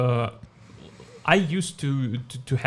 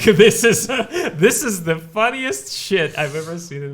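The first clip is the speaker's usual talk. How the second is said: laughing through the words, louder and higher; 6 semitones higher, 5 dB louder than usual. Retold or imitated. Guest retelling his reaction in a bar, laughing. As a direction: crack up while saying it. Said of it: "And I'm like".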